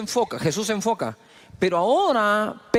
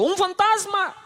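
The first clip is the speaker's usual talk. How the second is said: mock frightened cry; 13 semitones higher than usual. Disciples cry out in fear.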